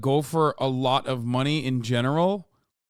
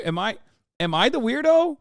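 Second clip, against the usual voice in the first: ordinary talk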